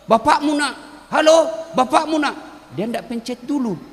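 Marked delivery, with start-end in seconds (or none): 0.0-2.4 a hint of an old village man yelling into the phone; lightly voiced old haji shouting hello; imitated shout. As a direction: call out higher, slow, like a rustic old man on the phone. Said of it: "dia teriak".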